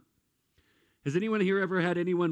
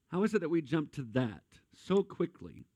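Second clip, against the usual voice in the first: ordinary talk, nothing marked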